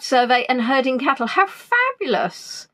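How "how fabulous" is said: high, slow, drawn-out gush of admiration; mock gushing. Real, not acted. Host gushes to a viewer about his wildlife survey, hammed-up. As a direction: gush over a viewer's news, playing it up.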